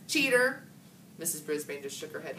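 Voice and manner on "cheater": angry retort. A narrator's reading. Gail retorting.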